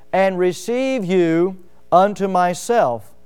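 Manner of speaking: drawn out, every word weighted; slow emphatic scripture. Shown as a demonstration. Pastor lingering over the verse for the congregation, slow and emphatic. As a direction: read it slowly to make it land. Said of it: "and notice this".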